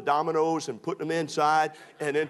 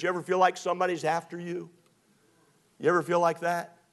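ordinary talk, nothing marked

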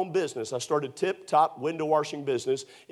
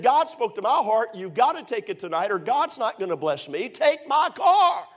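building insistence; 7 semitones higher, 3 dB louder than usual. Retold or imitated. Joe pleading with the preacher, building to a shout. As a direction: press harder and harder until it's a shout. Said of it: "He said".

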